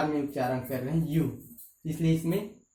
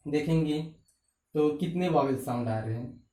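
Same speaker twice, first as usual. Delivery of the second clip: ordinary talk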